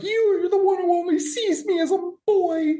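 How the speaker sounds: put-on high whiny teenage boy voice. Teen son whining at his mom, high and sulky. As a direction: whine it like a sulky kid. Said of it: "And he's like".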